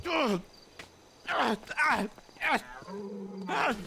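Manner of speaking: high repeated cries of pain; pained yells. Real, not acted. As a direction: cry out at each blow. Being trampled.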